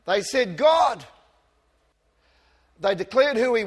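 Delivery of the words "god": a loud, higher cry to God; cried out. Retold or imitated. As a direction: call out to God with urgency. Crowd crying out to God.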